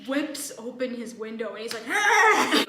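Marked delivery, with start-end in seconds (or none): 1.9-2.6 loud, high-pitched yell; unintelligible yell. Retold or imitated. Old hoarder yells out his window, loud incoherent yell. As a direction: holler an incoherent cry at full blast. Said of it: "I can't even hear what he, like, said".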